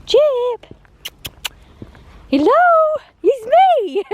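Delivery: high, loud sing-song call; calling the dog. Real, not acted. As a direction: call out to a dog that's wandered off.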